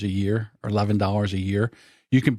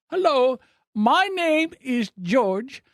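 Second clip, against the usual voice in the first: high, slow put-on caller voice, much higher than his own; imitating the telemarketer; 13 semitones higher, slower than usual. Retelling a telemarketer's call to his friend, high mocking caller voice. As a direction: mimic the overseas sales caller, drawn out. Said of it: "And they go".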